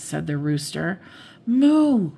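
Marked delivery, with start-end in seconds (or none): 1.5-2.1 higher, louder yelled name. A narrator's reading. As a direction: yell to get her attention. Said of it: "Minnie yelled".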